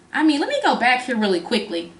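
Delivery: ordinary talk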